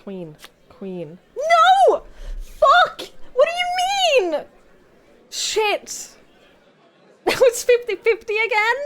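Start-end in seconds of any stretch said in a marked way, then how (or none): 1.3-6.1 much higher, louder outraged yells; furious shout. Real, not acted. Player screams when the wrong queen turns up. Bad card drawn, outburst. 7.2-8.8 higher, louder words through a laugh; laughing disbelief. Real, not acted. Player laughs at the odds turning against them.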